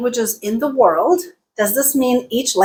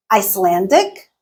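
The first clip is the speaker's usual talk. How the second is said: normal talking voice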